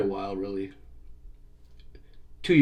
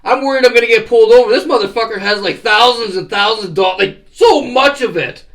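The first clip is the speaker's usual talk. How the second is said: loud, high, heated complaining; loud high rant at a reckless passenger; retold furious rant; 9 semitones higher, 7 dB louder than usual. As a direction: chew him out in a panic, loud and high. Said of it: "I'm like".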